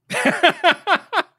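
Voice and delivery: high, loud staccato cackle; helpless high giggling; real burst of laughter. Real, not acted. Host laughing at co-host's refusal, real cackle.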